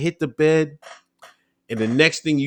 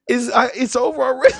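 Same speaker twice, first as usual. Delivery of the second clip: high, louder, half-laughing whimper; laugh-cry at your own bad luck; laughing disbelief; 13 semitones higher, 4 dB louder than usual. Recruit thinking he got kicked out, high laughing whimper.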